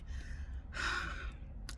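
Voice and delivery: long, breathy sigh of annoyance; sigh, holding back anger; exasperated sigh. Retold or imitated. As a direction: let out a heavy, soft sigh. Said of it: "But I was pissed. I'm like".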